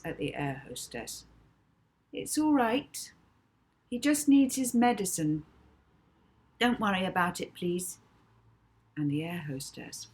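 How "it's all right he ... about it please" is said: reassuring. Acted. Molly reassures air hostess.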